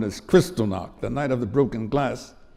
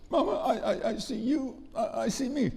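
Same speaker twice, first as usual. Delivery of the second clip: high, stammering imitation of a tearful boy; confused, crying child; 11 semitones higher, 4 dB quieter than usual. Confused boy answering mama, imitated tearful stammer. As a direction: falter as a bewildered crying child. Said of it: "And I'm confused".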